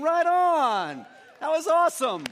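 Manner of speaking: high, shouted congratulations; excited praise. Real, not acted. Pastor praising the church's memory work, excited shout. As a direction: shout approval like a proud coach.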